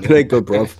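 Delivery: rough British accent, put on for a laugh; mock down-and-dirty British accent. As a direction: play at a British bruv. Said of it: "throw a couple of bruvs in there".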